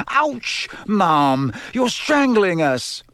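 high yelp, drawn-out complaint; pained protest. A narrator's reading. Son crushed in Mum's hug, high pained protest. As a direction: protest as if being squeezed. Twins squeezed in mother's tight hug.

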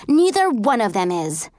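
usual speaking voice

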